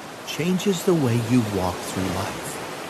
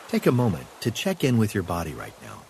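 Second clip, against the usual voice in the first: calm meditative tone. Acted. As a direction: guide the breath calmly. Guided breathing exercise.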